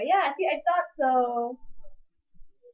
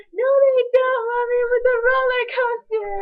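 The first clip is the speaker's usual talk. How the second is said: put-on much higher, loud and drawn out kid voice; insistent child; 10 semitones higher, 7 dB louder, slower than usual. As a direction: wail it like a kid sure he's right. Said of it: "he's like".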